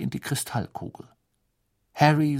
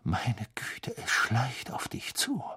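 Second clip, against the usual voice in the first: ominous whisper; 3 dB quieter than usual. Acted. Trelawney foretelling to Harry.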